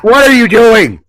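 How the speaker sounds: high, loud panicked yell; frantic shout. Acted. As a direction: scream at the villain to let him go. Host panics at captured cohost.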